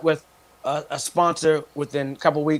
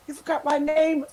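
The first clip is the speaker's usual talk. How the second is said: mock female voice; 10 semitones higher than usual. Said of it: "She's like".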